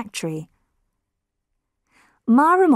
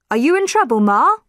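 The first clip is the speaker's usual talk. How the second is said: raised voice, higher, calling across the road; polite call; 5 semitones higher, 5 dB louder, faster than usual. A narrator's reading. Driver calls to Mara across the road, politely. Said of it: "the driver called out politely".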